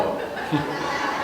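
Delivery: breathy chuckle under the breath; quiet real laugh after the joke; laughing at his own story; soft laugh. Real, not acted.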